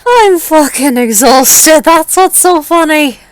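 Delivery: high, slow, laughing through exhaustion; exhausted giggling. Acted. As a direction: giggle out the words, worn out.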